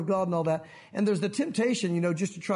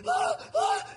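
shrieking very high, mock hysteria; hammed-up hysterical wail; hysterical woman. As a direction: squeal like a panicking movie heroine. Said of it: "woman who's just losing her own".

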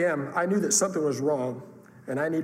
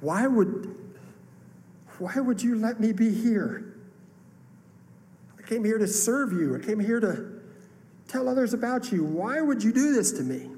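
higher-pitched, pleading complaint to God; mad at God; 7 semitones higher than usual. Retold or imitated. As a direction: protest upward, wounded and indignant. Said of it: "get a little mad at God".

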